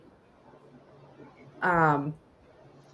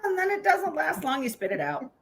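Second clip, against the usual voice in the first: normal talking voice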